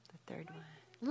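whispered prompt. Real, not acted. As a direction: whisper the hint to them. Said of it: "prompting the answer".

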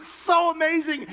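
high, loud tearful exclamation; overwhelmed with wonder; amazed joy. Retold or imitated. Cindy amazed that God told them both the same.

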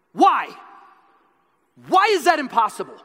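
loud, high challenging retort; defiant shout. Retold or imitated. Wallace volleys back at the nobleman, loud defiant. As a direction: fire the question straight back. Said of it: "He volleys right back".

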